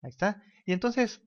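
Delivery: ordinary talk, nothing marked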